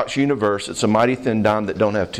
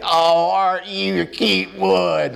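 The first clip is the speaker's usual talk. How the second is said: drawn-out, high Southern coo; higher, stretched-out Southern gushing; gushing Southern lady; 6 semitones higher, slower than usual. Retold or imitated. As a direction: fuss slowly over a toddler like a big Southern lady. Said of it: "like, Southern ladies. She's like".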